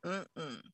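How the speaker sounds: low quiet "mm-mm" of no; a low, dropped hum of refusal; gut no hum. Retold or imitated. Host voicing a sacral no, low soft hum.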